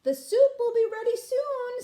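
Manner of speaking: high, loud sing-song wife; wife at the soup pot, high and bright-toned; high mother voice. Acted. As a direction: play the cheerful cook wife, high pitched. Mother speaks.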